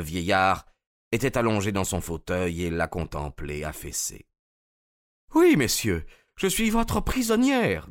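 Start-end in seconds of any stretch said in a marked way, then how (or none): 5.3-7.9 much higher woman's voice, dignified; Anna giving herself up to Holmes, dignified; Russian woman Anna. Acted.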